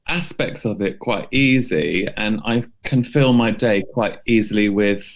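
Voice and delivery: ordinary talk, nothing marked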